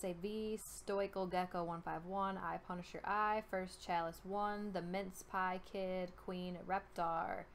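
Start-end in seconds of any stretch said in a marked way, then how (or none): none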